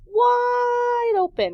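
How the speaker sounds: WIDE drawn out long, high and loud; stretched emphasis. Shown as a demonstration. Stretching word to illustrate birds' mouths.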